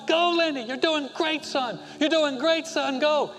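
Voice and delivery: high, fist-pumping cheer; root him home around the last curve; excited cheering. Retold or imitated. Father cheers his son through the last curve, high and excited. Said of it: "pumping his fist, going".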